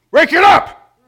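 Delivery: sudden high, loud "Break it up!"; shouted command. Shown as a demonstration. Pastor breaking up two people fighting, shouting. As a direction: bellow it to stop a fight. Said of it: "here, I'll, you know".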